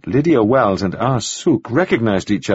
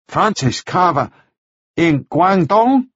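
slow, higher, halting question; puzzled foreigner; 8 semitones higher, slower than usual. A narrator's reading. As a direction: ask carefully, unsure. Ah Suk asks.

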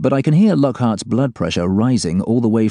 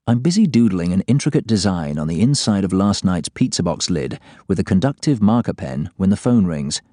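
plain reading voice

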